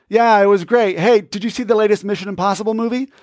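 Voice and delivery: quick dismissive pivot; brushing off. Shown as a demonstration. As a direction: rush past to your own topic. Demo of changing subject.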